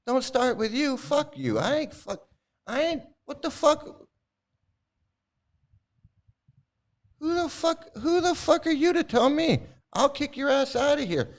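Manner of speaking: high, raging shout; furious yelling. Real, not acted. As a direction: lose it and yell at them. Furious outburst at a commenter.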